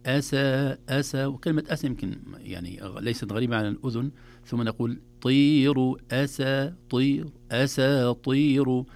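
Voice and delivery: أساطير broken up as أسا then طير, then joined slowly; split into syllables. Shown as a demonstration. Sheikh splits أساطير into syllables for students. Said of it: "نعلمها للطلاب مقطعة".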